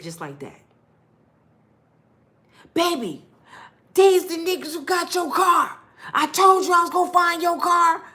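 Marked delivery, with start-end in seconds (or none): 2.7-8.0 high, loud fake-heroic shout; high, loud hammed-up hero act; hammed-up boyfriend. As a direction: play the liar caught red-handed. Boyfriend switches act, blames others.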